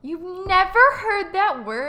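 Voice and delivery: can't believe they've never heard it; shocked disbelief. Real, not acted. Shocked that her co-host never heard chuggy, incredulous shriek. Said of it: "shocked co-host disbelief".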